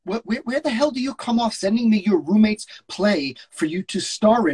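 hammed-up exasperated agent; outraged agent. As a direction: blow up at him. S0 reads George.

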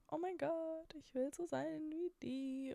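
high, starry-eyed fangirl gush. Retold or imitated. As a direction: swoon over an influencer. Quoting own gushing thought. (schwärmerisch)

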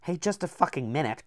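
a poet's angry interruption; cried out. A narrator's reading. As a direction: cut in loudly. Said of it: "cried Mi-, Martin Silenus".

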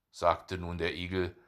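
ordinary delivery, nothing marked

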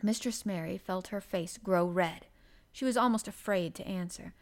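ordinary delivery, nothing marked

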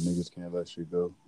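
usual speaking voice

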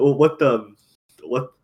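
normal talking voice

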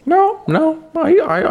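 nada tinggi memprotes; high protest. Real, not acted. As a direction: protest in a sudden high pitch. Defending how he plays clips.

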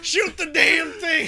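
put-on slow, high old-timer voice; cranky old coot barking an order; old prospector. Granddaddy voice bit continues.

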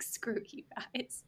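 hushed, whispery mock grumble; muttered retort. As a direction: grumble it slowly under your breath. Muttered retort to audiobook, retold.

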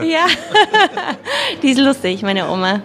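amused, laughing while talking. Real, not acted. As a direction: let the laugh spill into it. Laughing about funny grandma.

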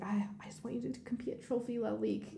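retelling mom's dream in a hushed whisper; whispered quote. Retold or imitated. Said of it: "and she's like".

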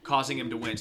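ordinary talk, nothing marked

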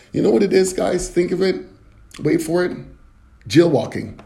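ordinary delivery

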